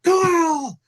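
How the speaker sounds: high, loud yelled name; screaming a name. Retold or imitated. Coach screams at his defensive end, loud high yell. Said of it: "And I'm screaming at him".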